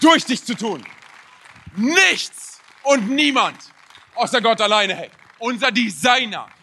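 loud, high, slow declaration with drawn-out key words; preaching at full force. Real, not acted. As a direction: proclaim it forcefully, word by weighty word. (mit voller Kraft verkündend)

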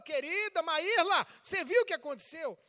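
loud, high-pitched confrontation; acted anger. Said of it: "tô com raiva".

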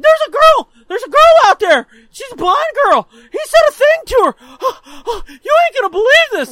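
very high, loud, gasping cries; hammed-up hysterical kid bursting to tell; frantic tattling brother. Brother to mom about a girl, frantic yelling. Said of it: "He's like".

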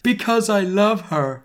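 high, put-on shriek; hammed-up shrieking Draco; shrieked declaration. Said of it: "Draco shrieked".

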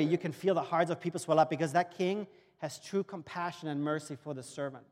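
ordinary talk, nothing marked

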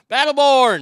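shouted shout-out. Real, not acted. Host hailing listener Battleborn in the chat, shouted shout-out. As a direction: belt out the name as a greeting.

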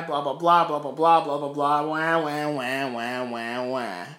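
imitating complainers, trailing down into wah-wahs; mock whining. Preacher mocking the fearful spies' complaints, mock whining. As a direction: drone out a mocking, falling whine.

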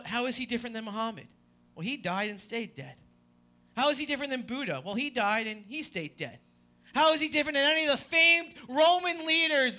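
ordinary talk

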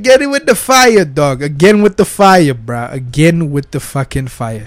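ordinary talk

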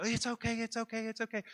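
high-pitched, repeated mock soothing; hurried reassurance. Hurriedly reassuring the frantic nurse.

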